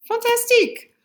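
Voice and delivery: high, bright cheer for the class; excited praise. Real, not acted. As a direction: cheer them on. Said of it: "praising class".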